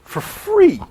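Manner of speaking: high, loud echo of the words; excited. Pretend. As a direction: throw it back with high excitement. Echoing partner in mock outrage.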